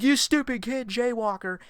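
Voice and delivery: high yelling trucker, shouting out his window; shrill mock yell from a truck driver; mock angry yell. Truck driver yelling at a jaywalker, mock angry yell.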